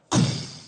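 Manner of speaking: loud mouth-made gunshot; imitated gunshot. Comedian acts the gunshot heard at camp, imitated bang. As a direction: burst out a sharp gunshot noise.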